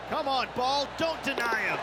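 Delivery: pleading with the ball; urging. Real, not acted. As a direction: coax the putt into the hole. Urging the putt to drop.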